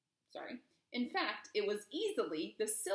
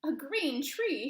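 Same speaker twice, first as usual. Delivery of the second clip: slow, louder and higher cry; crowd shout; 6 semitones higher, 7 dB louder, slower than usual. Acted. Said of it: "shouted the crowd".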